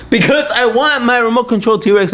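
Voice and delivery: high-pitched mock-angry kid; angry child. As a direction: answer back as an indignant child. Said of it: "why is the child so angry?".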